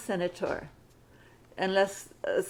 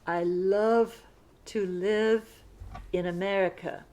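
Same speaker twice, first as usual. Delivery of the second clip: chunked dictation; 3 semitones lower than usual. Shown as a demonstration. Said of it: "Sentence fifty-five".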